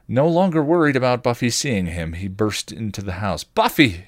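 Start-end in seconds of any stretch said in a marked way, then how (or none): 3.4-4.0 loud urgent call of her name; yelled name. A narrator's reading. Spike yelling for Buffy as he races in, loud. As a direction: yell for her as he bursts in. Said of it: "he yelled".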